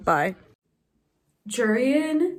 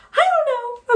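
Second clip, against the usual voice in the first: much higher and louder, exasperated; overwhelmed squeal; 14 semitones higher, 5 dB louder than usual. Real, not acted. Reader overwhelmed by a confusing romance, squealing. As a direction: burst out after the whisper, flustered.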